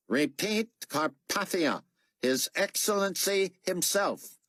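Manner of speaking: an officer issuing orders over the radio; stern radio officer. Acted.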